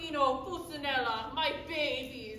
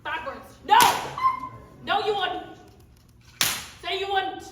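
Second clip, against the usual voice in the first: loud, higher bossy refusals; 4 semitones higher, 9 dB louder than usual. Acted. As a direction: boss the volunteer into backing down. Stepmother bullies audience member.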